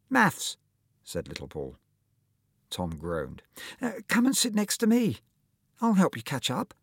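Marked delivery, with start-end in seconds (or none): 0.1-0.6 put-on higher, timid small boy; lightly voiced little boy. A narrator's reading. Little Paul answers. 3.8-6.7 put-on higher, timid small boy; lightly voiced little boy. A narrator's reading. Little Paul offers help.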